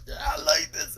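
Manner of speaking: high squeaky gushing admission; sister's squeal. Retold or imitated. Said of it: "And she's like".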